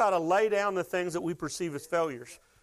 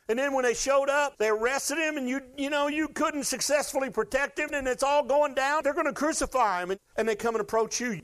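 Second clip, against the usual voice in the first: higher, urgent preaching; rising intensity; 7 semitones higher than usual. Acted. Preacher builds the arrest scene, higher and urgent. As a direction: drive the tension upward.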